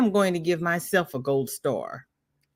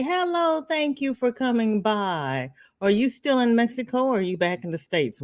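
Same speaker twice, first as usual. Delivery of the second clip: ordinary delivery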